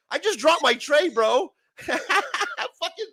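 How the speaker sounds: laughing through the words, pitch jumping up; real laughter breaking into the complaint; laughing rant. Real, not acted. Guest laughing to the panel about his spilled tray.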